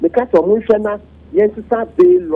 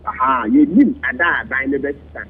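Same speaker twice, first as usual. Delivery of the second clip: ordinary talk, nothing marked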